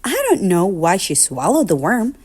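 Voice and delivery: usual speaking voice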